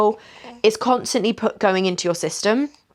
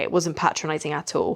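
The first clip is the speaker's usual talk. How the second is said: ordinary talk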